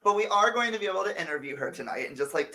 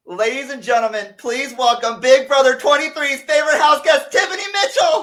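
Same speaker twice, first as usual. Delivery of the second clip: loud, rising build to a shouted name; announcer introduction; 7 semitones higher, 7 dB louder than usual. Pretend. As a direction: hype up the guest's big entrance. Host announces the surprise guest.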